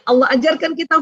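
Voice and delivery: ordinary delivery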